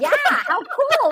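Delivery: high, slow sarcastic enthusiasm; mocking her lack of excitement, laughing; sarcastic cool. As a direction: laugh through fake awe. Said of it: "You're like".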